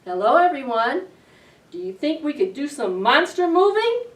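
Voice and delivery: ordinary delivery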